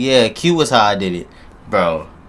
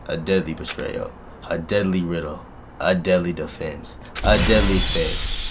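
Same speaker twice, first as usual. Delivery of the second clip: dramatic trailer voice. Acted. Reading title card, then joking.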